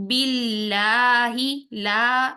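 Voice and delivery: باللہ slow, لا light with a clear aa; thin lam. Shown as a demonstration. Teacher showing the thin lam in باللہ. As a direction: keep the لام in باللہ thin and open. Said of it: "یہاں پر باریک کی sound".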